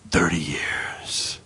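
breathy whispered verdict, very low; hushed sentence. Retold or imitated. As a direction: let the verdict drop to a whisper.

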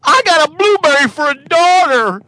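silly character voice. Acted.

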